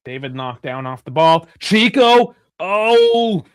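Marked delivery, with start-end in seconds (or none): 1.6-3.4 high, loud cry at a near chance; excited shout. Real, not acted. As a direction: shout the name as the chance opens up. Dangerous moment in live match.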